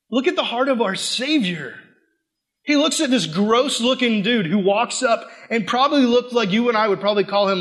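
ordinary talk

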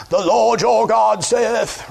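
slow, high, grandiose stage delivery; hammed-up Shakespearean proclamation; Shakespearean Bible-movie guy. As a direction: intone it like an old Bible epic. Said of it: "this big Shakespearean guy with a weird fake beard".

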